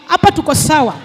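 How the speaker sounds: ordinary talk, nothing marked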